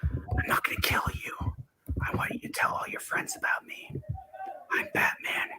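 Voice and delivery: gravelly whispered Batman growl. Acted. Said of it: "and I'm the Batman".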